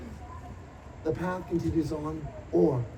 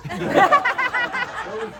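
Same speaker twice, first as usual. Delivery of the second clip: laughing plea; 6 dB louder than usual. Real, not acted. Laughing delight at the attack.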